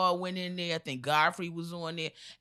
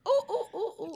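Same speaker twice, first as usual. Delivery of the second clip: monkey hoots; 14 semitones higher than usual. Acted. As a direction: answer with high ape calls. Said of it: "And the monkey goes".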